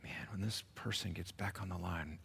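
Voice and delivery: low-volume grumble under the breath; hushed mock threat; impatient muttering. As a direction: mutter it softly, acting fed up on hold. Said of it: "When you're getting impatient".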